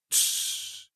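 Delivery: soft voiceless hiss; hissed shush. A narrator's reading. Felix silencing his whimpering captive, hissed shush. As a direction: hush him with a long hiss. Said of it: "прошипел Феликс".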